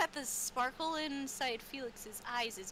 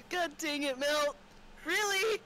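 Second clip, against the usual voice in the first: mock exasperation; 5 semitones higher, 4 dB louder than usual. Mocking player's exasperation.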